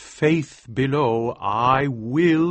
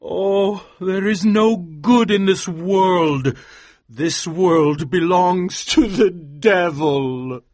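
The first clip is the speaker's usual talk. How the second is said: higher, slowish hopeless lament; despairing; 7 semitones higher, 3 dB louder, slower than usual. Acted. Man who lost his faith, slow despairing lament. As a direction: mourn that the world is lost. Despair after finding the ribbon.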